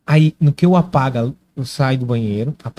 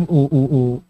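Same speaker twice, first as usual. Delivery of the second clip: normal talking voice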